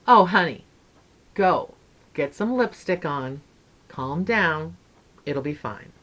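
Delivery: low, soft, unhurried comforting lady; soothing reassurance. Retold or imitated. Lady soothes the flustered mother.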